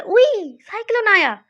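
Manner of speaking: high, loud startled squeal; a sudden frightened yelp at the storm; startled cry. Pretend. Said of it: "तो आपने बोला". (डरकर ऊँची, तेज़ चीख)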